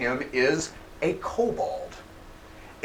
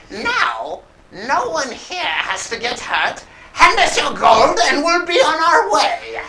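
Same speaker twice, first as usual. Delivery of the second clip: high-pitched villain voice, rising in volume; kobold bandit demand; 11 semitones higher, 4 dB louder than usual. Acted. Bandit demanding the party's gold, high and menacing. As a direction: deliver the hold-up in a high, put-on voice.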